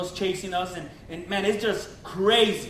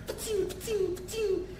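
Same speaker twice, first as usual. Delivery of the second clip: high, soft mimicked shots; imitated gunshots; 10 semitones higher, 7 dB quieter than usual. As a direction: mimic distant gunfire with your mouth. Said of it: "the guy's just blasting at me".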